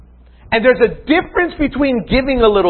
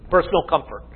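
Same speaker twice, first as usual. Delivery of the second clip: softer, lower echo of the heading; quiet repeat; 5 semitones lower, 6 dB quieter, faster than usual. Shown as a demonstration. Preacher repeating his point to the congregation, softer and lower. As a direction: drop the voice and let it sink in. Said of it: "Let's look at them briefly".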